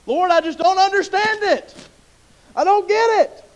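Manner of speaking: high, loud exasperated prayer; frustrated cry to God. Acted. Believer cries out high and loud to God in frustration. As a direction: throw up your hands and cry out.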